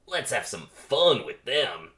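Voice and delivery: slow, loud, lightly voiced jock; pollen jock. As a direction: drawl it like a cocky athlete. Pollen jock plotting to tease bees.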